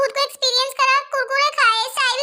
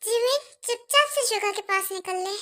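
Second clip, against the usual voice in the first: hushed aside; 6 semitones lower, 6 dB quieter than usual. Acted. Telling friend to slip away quietly.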